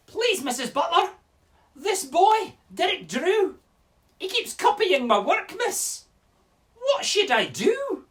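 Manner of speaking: high, loud Scottish schoolchild; Scottish accent. Acted. Scottish pupil telling Missus Butler about Derek Drew, high plaintive accent. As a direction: complain to the teacher in a high Scottish child's voice. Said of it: "this is my best Scottish accent".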